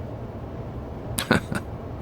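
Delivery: soft snicker under the breath. A narrator's reading. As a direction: snicker quietly to himself. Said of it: "He snickered".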